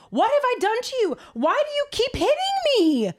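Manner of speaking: very high, fast, put-upon donkey voice; hurt donkey. Retold or imitated. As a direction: whine it like a wronged animal. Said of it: "he tells him".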